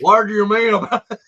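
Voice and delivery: loud mock-indignant comeback; indignant retort. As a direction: fire back loudly in mock outrage. Texan's indignant retort to teasing.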